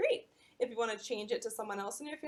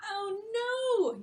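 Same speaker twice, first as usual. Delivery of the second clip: dismayed cry; 10 semitones higher, 6 dB louder than usual. Acted. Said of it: "Yumi cried".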